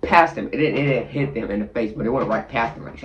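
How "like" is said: ordinary talk, nothing marked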